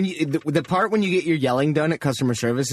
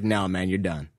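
deep, quiet, final; low bouncer voice; 10 semitones lower, 4 dB quieter, slower than usual. Retold or imitated. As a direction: shut him down like a bouncer. Bouncers refuse entry.

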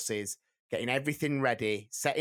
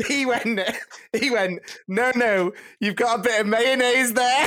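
laughing through the punchline, higher and louder; can barely get the words out for laughing; 11 semitones higher, 9 dB louder than usual. Real, not acted. Telling friends the inspector's reply, laughing hard.

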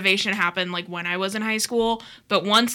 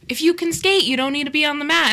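raised stern teacher voice; 7 semitones higher, 3 dB louder than usual. Retold or imitated. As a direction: imitate the teacher, higher and firm. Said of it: "the P E teacher was like".

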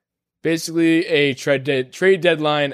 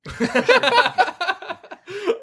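high laugh. Real, not acted. Laughs at soundboard rating.

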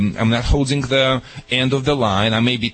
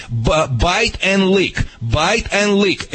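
slow emphasis; 4 semitones higher, slower than usual. Shown as a demonstration. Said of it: "rhythmic demo".

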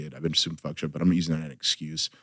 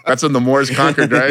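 talking through a laugh, high and loud; giggling out the punchline; 7 semitones higher, 7 dB louder than usual. Real, not acted. Guest jokes about his ancestry, laughing.

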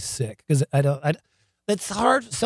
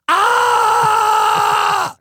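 loud, high sustained scream; imitated roar held at full volume; long yelled "ah"; 7 dB louder than usual. The biker he punched answers him, long imitated scream.

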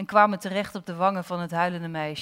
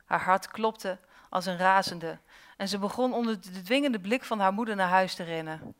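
plain reading voice

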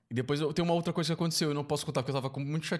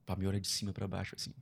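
ordinary talk, nothing marked

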